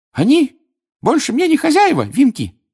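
loud, high elf retort; squeaky house-elf standing up boldly; defiant Dobby. A narrator's reading. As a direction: throw it back with a challenge. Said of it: "с вызовом произнёс Добби".

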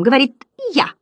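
high comic report; clown butterfly. A narrator's reading. First butterfly to the others, high clown voice.

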